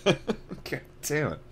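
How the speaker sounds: laughing at a slip. Real, not acted. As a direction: swear through a laugh. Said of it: "laughing at own mistakes".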